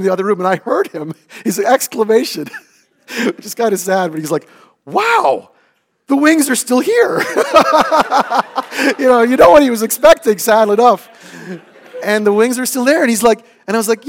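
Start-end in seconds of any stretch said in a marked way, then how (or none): 4.8-7.2 high, loud surprised exclamation; surprised son. Retold or imitated. 7.3-11.1 laughing aside. Real, not acted.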